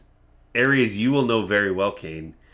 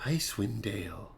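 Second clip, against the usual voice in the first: soft, far-away musing; hushed, wondering echo; lost in thought; 11 dB quieter than usual. Acted. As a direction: murmur it to yourself, remembering. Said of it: "keeps talking like this".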